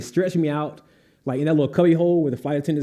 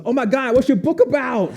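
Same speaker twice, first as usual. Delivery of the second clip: high, louder mock-gushing attendant; gushing excitement; 10 semitones higher, 3 dB louder than usual. Flight attendant to fainted author, high gushing excitement. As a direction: imitate a suddenly thrilled attendant.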